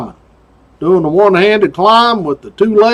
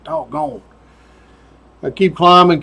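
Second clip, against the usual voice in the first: ordinary talk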